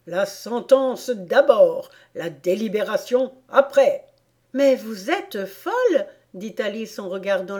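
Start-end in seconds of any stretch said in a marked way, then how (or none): 0.1-4.0 crying out. A narrator's reading. The queen demanding sentence before verdict, crying out. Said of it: "cria la reine". 4.6-6.1 higher, louder, indignant protest; defiant Alice. A narrator's reading. Alice looking straight at the queen, defiant.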